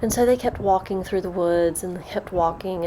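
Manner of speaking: ordinary delivery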